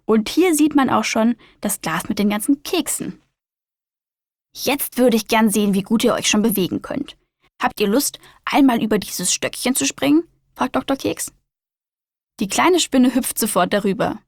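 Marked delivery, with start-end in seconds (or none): 4.5-14.2 lively storybook reading voice; reading to children. A narrator's reading. Host reading a spider story to young listeners, lively storyteller voice. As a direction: read it like a bedtime picture book.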